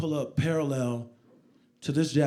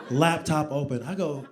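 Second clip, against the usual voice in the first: ordinary delivery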